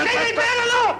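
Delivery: high indignant yell; protesting yell. Acted. Man fighting off beard shavers, protesting yell.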